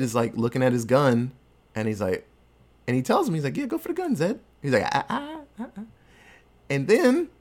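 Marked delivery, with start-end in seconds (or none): 3.6-4.4 much higher, teasing sing-song; high-pitched parody taunt at the villain; mock taunt. Retelling Butch taunting Zed. 4.9-5.8 much higher, wagging "ah ah ah"; high teasing no-no-no; mock warning. Retelling Butch warning Zed.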